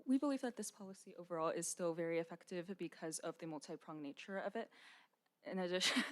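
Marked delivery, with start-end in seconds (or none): none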